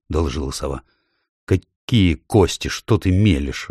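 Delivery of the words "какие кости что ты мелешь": plain reading voice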